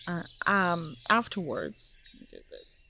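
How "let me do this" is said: unvoiced whispered aside; whispering. Real, not acted. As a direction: whisper it to yourself. Aside while reading.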